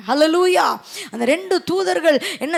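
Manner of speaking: usual speaking voice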